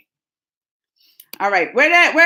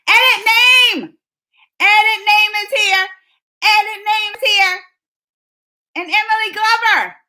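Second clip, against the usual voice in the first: higher, louder hype announcing; announcer call-out; 6 semitones higher, 4 dB louder than usual. Real, not acted. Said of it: "announcing arrivals".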